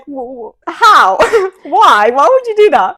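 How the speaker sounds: high, loud baffled protest, retold; mock disbelief. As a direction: act out appalled workmates, laughing between words.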